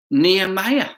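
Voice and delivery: plain reading voice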